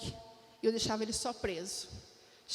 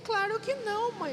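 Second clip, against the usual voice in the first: imitating daughter; 9 semitones higher than usual. Daughter reassuring her bald mother, high eager. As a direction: jump in high like a proud kid. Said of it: "Ela falou".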